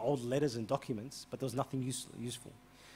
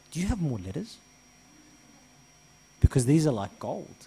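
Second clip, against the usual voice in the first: raised, urgent voice, louder; heated insistence; 3 semitones higher, 9 dB louder than usual. Retold or imitated. Priest pressing the old man for letters, loud and urgent. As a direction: push hard, this matters.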